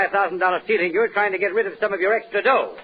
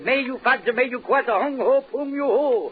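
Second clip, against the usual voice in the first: mock Chinese quote; 5 semitones higher than usual. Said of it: "He said".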